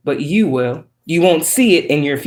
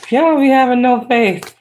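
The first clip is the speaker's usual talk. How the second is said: mock scolding; 7 semitones higher than usual. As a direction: tease them in a sassy high voice. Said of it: "I'm playing".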